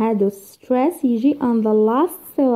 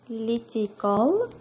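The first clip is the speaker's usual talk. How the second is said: "Political" very slow and drawn out; divided into syllables; 3 dB quieter, much slower than usual. Shown as a demonstration. Teacher dividing the first word for students, slow syllable-by-syllable "Political". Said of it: "We divide the word into syllables".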